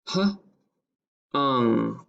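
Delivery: "H-ung" as h, long pause, then ung; sounded out. Shown as a demonstration. Teacher repeating for dictation, "H-ung" pulled apart slowly. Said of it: "and ung".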